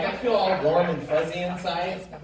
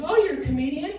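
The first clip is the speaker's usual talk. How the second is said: mock surprised stranger, higher pitch; a curious stranger asking, lightly mocked; mimicking a stranger; 12 semitones higher, 6 dB louder than usual. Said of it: "they find out what I do for a living".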